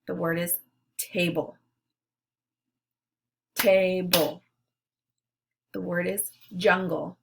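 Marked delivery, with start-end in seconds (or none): 3.5-4.4 "Giggle" split into gig - gle, each beat punched; syllables clapped out. Shown as a demonstration. Teacher models clapping syllables for the class, "Giggle" beat by beat. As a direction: clap out "Giggle" one syllable at a time. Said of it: "then clap out the syllables".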